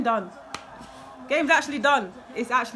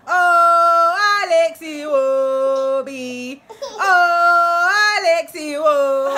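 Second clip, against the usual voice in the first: loud, slow laughing cry of the name; real ecstatic shout; joyful yell; 5 semitones higher, 8 dB louder, much slower than usual. Real, not acted. Celebrating Iwobi's goal.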